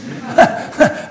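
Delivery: brief laugh at own joke; short laugh. Real, not acted. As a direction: chuckle at your own quip. Laughs at own tear gas joke.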